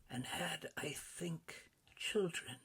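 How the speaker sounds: a gentle ghost reminding him; ghost's voice. A narrator's reading. Ghost continues.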